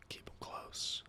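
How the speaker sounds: breathy whispered repeat of keep 'em close. Shown as a demonstration. Streamer whispering advice to viewers, as a whisper. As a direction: whisper close as if sharing a secret. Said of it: "Just keep 'em close".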